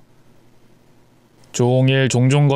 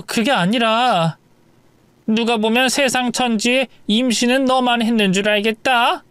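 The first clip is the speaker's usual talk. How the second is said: higher, scornful mother-in-law rant; berating mother-in-law; 6 semitones higher than usual. Acted. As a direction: lash out at the lazy daughter-in-law. Mother-in-law berating pregnant wife. (임신한 며느리를 몰아세우는 높고 비꼬는 시어머니 목소리)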